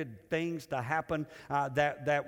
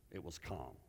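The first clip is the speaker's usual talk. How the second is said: ordinary talk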